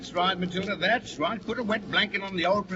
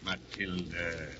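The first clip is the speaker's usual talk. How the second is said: low, slow warning; 12 semitones lower, 8 dB quieter, much slower than usual. Acted. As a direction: rein her in with her name. Husband warns nagging wife.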